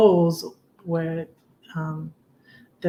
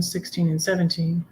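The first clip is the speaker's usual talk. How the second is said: usual speaking voice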